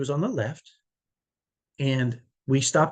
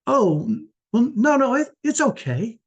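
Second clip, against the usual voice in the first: higher, suddenly meek and apologetic; a raging trucker caught off guard, now falling over himself to calm things; flustered backing down; 8 semitones higher, 3 dB louder than usual. Retold or imitated. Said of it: "went from a hundred down to zero".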